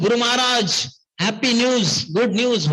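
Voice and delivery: high-pitched imitation of gleeful news-bringers; happy announcing. Disciples breaking news to their guru, happily excited. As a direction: call out the good news in mock delight. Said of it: "They were so happy to tell him".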